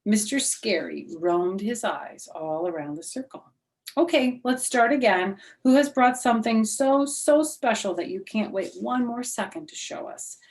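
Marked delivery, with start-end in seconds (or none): 3.9-10.4 teacher's voice. Acted. Teacher voiced in read story.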